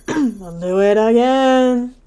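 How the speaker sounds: loud, slow, drawn-out announcement; singsong, long-held last word; mock defiant. Real, not acted. Mock weary rereading of the laws.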